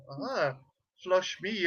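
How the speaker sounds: soft, low teasing moan; mock sultry flush me. [name] imitates Jen cooing at toilet flush, soft mock moan. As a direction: purr it like a come-on. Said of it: "you were like".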